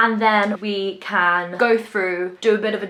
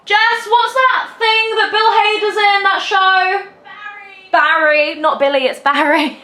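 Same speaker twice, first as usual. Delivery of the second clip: high, louder call to another room; 11 semitones higher, 6 dB louder than usual. Real, not acted. Calls out to Jess to ask about the show. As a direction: shout the question across the house. Calling out to someone.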